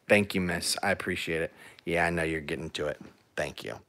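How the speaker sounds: hushed, soft praise for the dog; almost under the breath, calm and soft. Real, not acted. Owner softly thanking her dog for sitting down.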